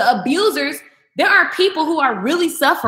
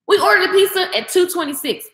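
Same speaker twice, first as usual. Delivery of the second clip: usual speaking voice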